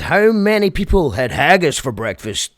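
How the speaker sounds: lightly voiced mock Scottish crowd-banter; stupid mock Scottish accent. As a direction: mimic a rock star faking Scottish. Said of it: "he put on a stupid accent".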